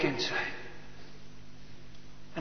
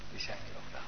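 "Die zijn er nog wel" breathed as a soft whisper; whispered echo; 20 dB quieter, much faster than usual. Shown as a demonstration. Preacher echoing himself to the congregation, whispered. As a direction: let the repeat drop to a whisper.

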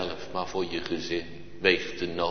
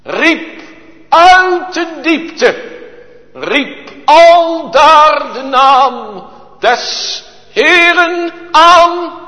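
high, loud, slow preacher's cry; preaching at full force; 18 dB louder, much slower than usual. Acted. Preacher crying out to the congregation, high, loud and slow. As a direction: stretch every word like a revival preacher.

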